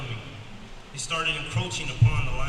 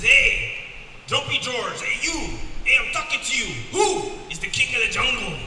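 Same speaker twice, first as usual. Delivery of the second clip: cocky lion; 8 semitones higher, 5 dB louder than usual. Acted. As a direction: swagger like a street-tough lion, chest out. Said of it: "He says".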